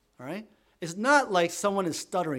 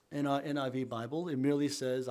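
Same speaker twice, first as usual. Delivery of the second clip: ordinary talk, nothing marked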